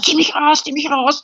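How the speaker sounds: high, pleading call to be pulled out; retold scream. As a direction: beg the pilot to pull you free. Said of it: "ich hab geschrien".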